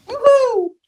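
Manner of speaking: high, joyful woo-hoo; excited cheer. Real, not acted. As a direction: cheer happily at the welcome. Guest cheers at welcome.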